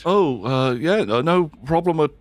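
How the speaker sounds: high, flustered reply; eager to please the officer; nervous crew kid. Acted.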